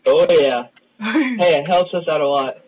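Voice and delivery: usual speaking voice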